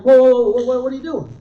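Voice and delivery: high, loud burst of whoas, then easing; mock alarm, stopping someone in their tracks; comic retold "hold on" panic; alarmed protest. Retold alarm at wife's mistake.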